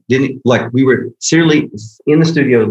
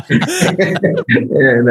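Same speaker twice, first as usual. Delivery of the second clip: laughing; 9 semitones higher than usual. Real, not acted. Host tells surprising story.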